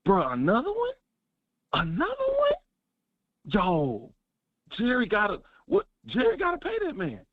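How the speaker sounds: excited disbelief. Retold or imitated. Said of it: "And I was like".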